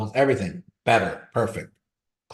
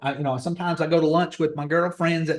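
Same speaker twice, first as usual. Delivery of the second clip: higher-pitched Georgia twang, making excuses; put-on rural Georgia accent, pitched up; country bumpkin accent; 5 semitones higher than usual. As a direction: imitate a sister hedging in her country accent. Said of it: "She's got a country bumpkin accent".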